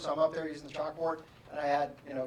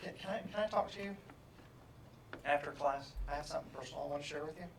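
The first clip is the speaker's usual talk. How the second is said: shy student; 9 dB quieter, faster than usual. Retold or imitated. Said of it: "and she says".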